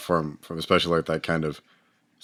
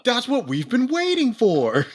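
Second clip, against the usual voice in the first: much higher and slower, giddy; excited; 3 dB louder than usual. Real, not acted. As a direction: stretch it out like an eager fan. Excited fan agreement.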